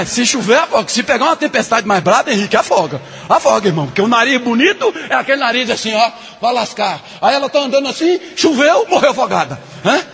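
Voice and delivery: high, loud, driving sermon voice; full-force preaching. Acted. Preacher to the women of the church, full-force preaching.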